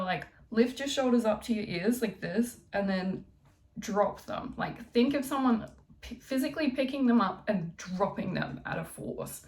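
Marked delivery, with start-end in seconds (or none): none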